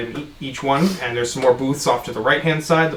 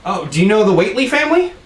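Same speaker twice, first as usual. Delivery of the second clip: excited bartender Dennis; 8 semitones higher than usual. Acted. Bartender Dennis introduces himself.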